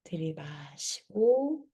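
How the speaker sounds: soft, slow breathing guide; guided breathing cues. Shown as a demonstration. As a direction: cue each breath gently and slowly. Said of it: "사 초 한번 해볼게요".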